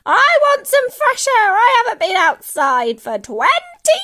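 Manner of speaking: put-on high screechy old woman's holler; full-force shrieked complaint; demanding shout. A narrator's reading. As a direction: yell it out with wild determination. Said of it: "she shouted".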